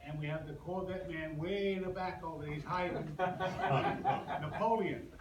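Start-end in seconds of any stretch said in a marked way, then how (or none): none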